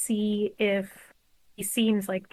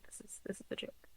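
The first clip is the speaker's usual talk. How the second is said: ordinary talk, nothing marked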